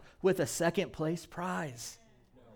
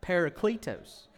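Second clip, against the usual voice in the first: Parakletos, Midwestern PAIR-uh-klee-toes; playful Midwestern Parakletos; Midwestern accent; 3 dB quieter than usual. Shown as a demonstration. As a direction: land Parakletos in Midwestern again. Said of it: "whichever dialect".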